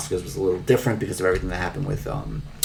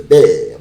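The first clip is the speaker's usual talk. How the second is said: mock tongue-out bleh; 10 dB louder than usual. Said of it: "goes like".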